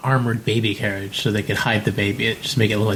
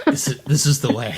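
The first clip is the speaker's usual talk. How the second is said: laughing; 3 semitones higher than usual. Pretend. Quoting the show's catchphrase with laugh.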